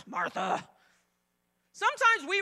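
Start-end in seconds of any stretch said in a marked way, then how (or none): 0.1-0.7 curt, irritated name; annoyed. Retold or imitated. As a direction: snap the name impatiently. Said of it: "He wasn't like".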